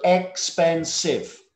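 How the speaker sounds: "expensive" slowly split into three beats, ex-pen-sive; syllable by syllable. Shown as a demonstration. Teacher counting syllables for students, "expensive" said slowly beat by beat. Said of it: "that's three syllable".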